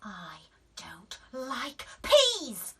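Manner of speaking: slow whisper; whispered refusal. A narrator's reading. Daisy whispering no again. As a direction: hush it out slowly. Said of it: "said Daisy".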